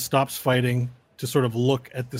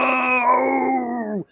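desperate cry; 11 semitones higher than usual. Acted. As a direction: cry out as he's sucked away. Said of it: "He goes".

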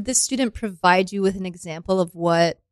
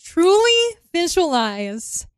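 pompous coach; 7 semitones higher than usual. Pretend. Co-teacher coaching solemnly.

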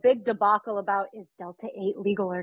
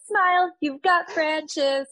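slow, higher, sing-song ad announcer; mustard commercial voice; 8 semitones higher, 3 dB louder, much slower than usual. Retold or imitated. Kid reenacting a French's mustard commercial for friends, hammed-up ad voice. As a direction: stretch the slogan out like a kid playing TV ad. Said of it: "like, the French's mustard".

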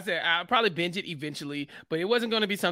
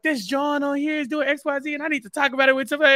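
higher, fast, hyped friend ranting about a show; Bryce, worked up; 7 semitones higher, 4 dB louder than usual. Retold or imitated. Friend calling Chappelle about the show, higher hyped rant. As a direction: rattle it off like an excited friend. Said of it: "he's like".

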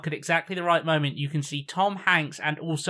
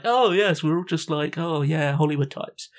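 mock Hollywood types. Mocking smug Hollywood types.

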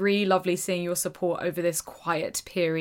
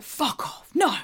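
mock disbelief, building to a loud high no; scandalised disbelief, last word shooting up; incredulous exclamation; 12 semitones higher, 3 dB louder, much slower than usual. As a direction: exclaim it like you can't believe it. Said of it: "People say it all the time".

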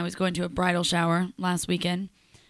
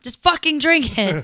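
exasperated shout; 6 semitones higher, 9 dB louder than usual. Retold or imitated. Retold promo, pushing the drink.

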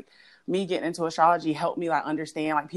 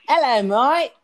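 higher, loud fake Australian hello; mock Australian accent; 5 semitones higher, 8 dB louder, slower than usual. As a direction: ham up an Aussie greeting. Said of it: "That's not how we sound".

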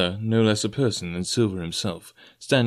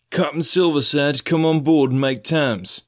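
louder, higher, slow hollered message; shouted message; 5 semitones higher, 4 dB louder than usual. Acted. Messenger shouting terms to the captain, loud and slow. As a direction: shout the terms across the yard. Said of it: "he shouted".

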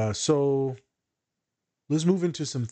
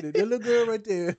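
mock callous; 8 semitones higher than usual. Joking about disposable characters.

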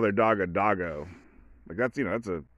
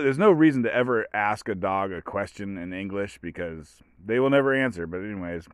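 usual speaking voice

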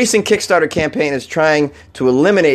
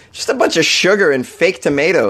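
ordinary talk

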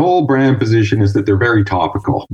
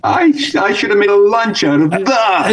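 mock self-important actor; 8 semitones higher than usual.

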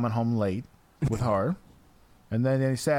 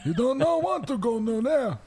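put-on grumpy old man; 12 semitones higher than usual. Imitating neighbor Pete's warning.

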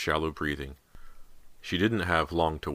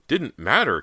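high, loud outraged echo; furious cry; 9 semitones higher, 7 dB louder than usual. A narrator's reading. Callie to sister about the red paint, crying furiously. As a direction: throw it back in loud outrage. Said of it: "Callie cried furiously".

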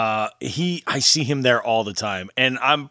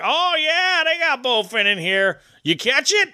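higher-pitched, lightly voiced drunk local; eager, pitched-up angler chatter; drunk fishing regular; 11 semitones higher than usual. Host voices the drunk regular at his fishing spot, pitched up. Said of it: "And he's like".

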